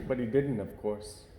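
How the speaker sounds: ordinary delivery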